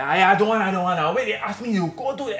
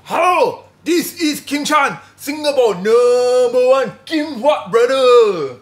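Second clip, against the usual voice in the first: brother's voice; 5 dB louder, much slower than usual. Acted. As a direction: play the proud big brother introducing himself slowly. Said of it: "my brother got the hairstyle".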